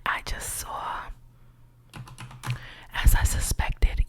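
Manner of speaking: normal talking voice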